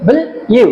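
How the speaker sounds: ব্লু cut short, then a separate ইউ; separate sounds. Shown as a demonstration. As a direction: split ব্লু into its opening and a final ইউ. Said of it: "কিন্তু আলাদা".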